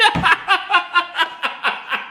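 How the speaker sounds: long high giggling laugh; helpless laughter. Real, not acted. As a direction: crack up at your own joke. Cracks up at his own joke.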